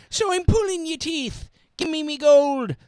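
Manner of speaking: high squeaky leprechaun demand. Acted. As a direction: snarl it like a greedy leprechaun. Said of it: "That's what leprechauns say".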